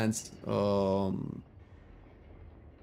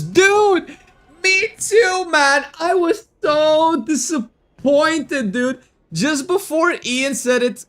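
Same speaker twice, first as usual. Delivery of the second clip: loud, high, impassioned fan rant; exasperated fanboy shouting to his chat; worked-up outburst; 8 dB louder, slower than usual. Real, not acted. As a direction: wail it out, drawing out the disappointment. Reliving his big disappointment over Tinkers.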